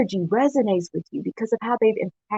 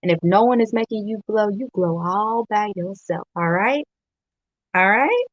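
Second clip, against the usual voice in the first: normal talking voice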